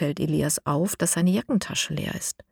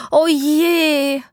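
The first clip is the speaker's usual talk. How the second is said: dismayed cry; 10 semitones higher, 6 dB louder than usual. A narrator's reading. Rocket smoking, dismay.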